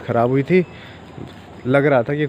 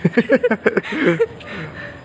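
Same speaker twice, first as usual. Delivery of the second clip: laughing; 9 semitones higher than usual. Real, not acted. Vlogger laughs at the bit.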